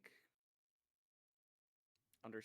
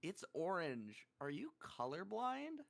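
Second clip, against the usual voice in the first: drawn-out, high-pitched child imitation; incredulous little girl; 6 semitones higher than usual. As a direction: mimic a kid questioning in disbelief. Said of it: "The girl with the blue cup goes".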